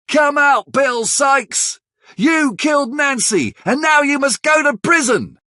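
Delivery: very high, chanting mob call; angry crowd shouting. Acted. The crowd outside demands Bill, very high mob shouting. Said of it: "They start shouting".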